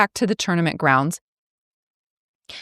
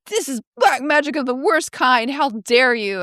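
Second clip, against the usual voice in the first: mock outrage; 7 semitones higher than usual. Ector scolding Merlin, retold.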